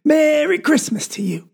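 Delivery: Papa waving the chickens at his children, a mild mock yell. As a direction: call it out happily to the kids. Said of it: "waving them two chickens and yelling".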